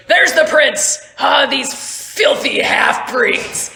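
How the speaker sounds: high, loud, contemptuous shout; furious militia captain's outburst; Captain Merrick. Acted. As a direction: bellow with disgust, drawing out the insult. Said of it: "So Captain Merrick goes".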